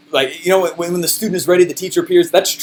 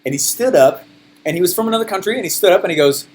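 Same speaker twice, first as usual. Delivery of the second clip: ordinary delivery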